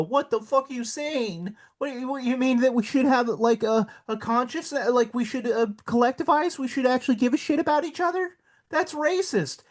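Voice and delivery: high, whiny mock voice, pitched well up. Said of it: "they get all fucking butthurt and go".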